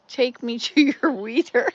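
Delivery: loud punchline bursting into a laugh; real laughing. Real, not acted. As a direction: crack up on the punchline. Cracks up telling joke punchline.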